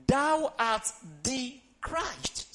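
drawn out and weighty, each word spaced; slow deliberate reading of scripture; slow solemn declaration. Shown as a demonstration. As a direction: proclaim it slowly, letting each word land. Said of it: "Say it louder".

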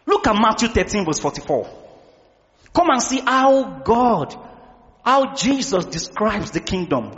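normal talking voice

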